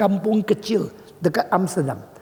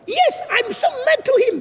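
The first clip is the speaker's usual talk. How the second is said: high-pitched furious retort; very angry; 9 semitones higher, 3 dB louder than usual. Retold or imitated. Dutch woman answers why she's mad, high angry rant. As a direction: rant back in a high, heated voice. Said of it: "Kenapa lu marah sekali".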